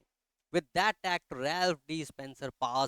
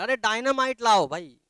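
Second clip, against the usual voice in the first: much higher and louder yell; loud shout; 10 semitones higher, 10 dB louder than usual. Retold or imitated. Bystander yelling for dynamite at the vault, loud shout. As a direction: bark out the wild idea. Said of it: "जोर से चिल्ला के बोला".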